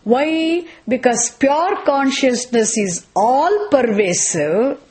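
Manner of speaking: usual speaking voice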